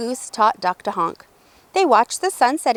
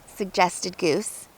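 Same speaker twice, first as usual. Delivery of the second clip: ordinary delivery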